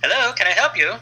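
lightly voiced polite editor on the phone. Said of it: "he's like".

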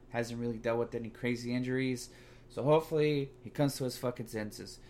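ordinary delivery

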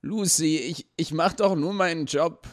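high, begging stammer; pleading. A narrator's reading. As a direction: beg her, flustered. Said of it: "flehte Toni". (flehend, deutlich höher)

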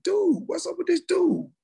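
high, slow puzzled voice; puzzled cousin voice. Retold or imitated. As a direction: sound baffled and drawn out. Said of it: "they listening like".

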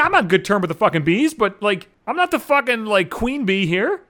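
normal talking voice

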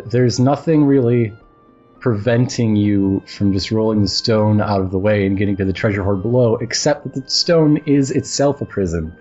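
normal talking voice

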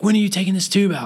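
high-pitched, insistent imitation of a relative; demanding daughter. Retelling the demanding daughter's question.

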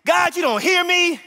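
loud, high, whiny complaint to God; complaining people. Retold or imitated. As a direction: shout out the people's grievance. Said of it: "the people are like".

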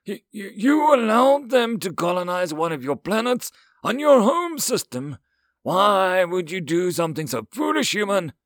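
high, loud, stammering outburst; sputter, then shout in appalled disbelief; astonished exasperation. A narrator's reading. Alien counselor appalled by the human's colony deal, astonished. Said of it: "to show his astonishment, dread, and exasperation".

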